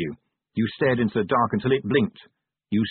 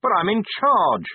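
high-pitched, indignant protest; acted plaintive protest; 9 semitones higher, 4 dB louder than usual. A narrator's reading. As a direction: object with pitch raised, pushing on "charge". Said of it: "Vimes protested".

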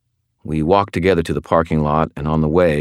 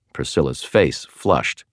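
ordinary delivery, nothing marked

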